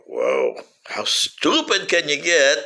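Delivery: usual speaking voice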